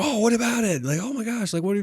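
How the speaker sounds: high, loud, flustered gushing; a rush of giddy surprise; excited disbelief. Retold or imitated. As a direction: retell your own overexcited gasp of disbelief. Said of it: "And I was like".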